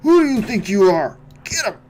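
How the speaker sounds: high, loud furious yell; rally them into the attack; battle shout. Real, not acted. Charging into the fight.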